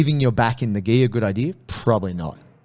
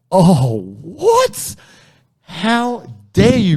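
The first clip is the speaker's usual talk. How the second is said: high, loud mock indignation, slowed on how dare you; mock outrage; 8 semitones higher, 6 dB louder, much slower than usual. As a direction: play up the offended shock. Mock outrage at being called hobbyist.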